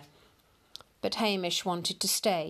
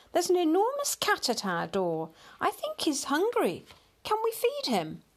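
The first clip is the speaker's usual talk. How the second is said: put-on high-pitched eager child voice; excited little boy; 10 semitones higher than usual. A narrator's reading. Little boy asking mum to feed the cat, excited. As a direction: plead high and keen like a small boy. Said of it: "called the little boy".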